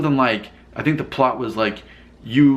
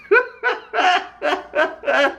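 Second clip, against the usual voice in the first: high-pitched burst of laughter. Real, not acted.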